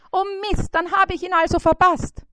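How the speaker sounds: high, fast dismayed fly squeak; oh no, too late. A narrator's reading. Fly Bissi realises it missed its appointment, high and fast.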